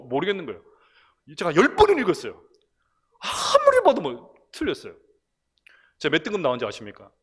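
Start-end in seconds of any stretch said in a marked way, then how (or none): 3.2-4.3 아무리 drawn out long, high and loud; exasperated bafflement. Retold or imitated. Pastor recounting a baffling dialect test, exasperated bafflement.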